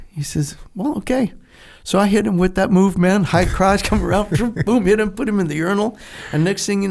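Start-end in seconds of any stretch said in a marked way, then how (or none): 0.8-1.3 mock cocky okay. Little Nick accepting fight.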